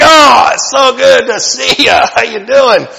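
slow, high, loud mock greeting; chirpy greeter. Preacher imitating a restaurant greeter, hammed-up cheerful. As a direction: ham up an overly cheerful server welcome.

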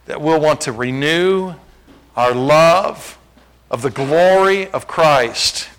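slow, solemn pulpit prayer; public prayer. Real, not acted. Pastor prays to Father that church renew love, solemn. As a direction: pray aloud in measured phrases, stretching key words.

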